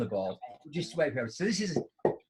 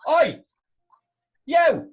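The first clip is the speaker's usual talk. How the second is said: loud high "Oi! Yo!" yelled across a car park; shout; 13 semitones higher, 9 dB louder than usual. Retold or imitated. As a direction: bellow to get attention from far off. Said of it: "I don't know who's shouting".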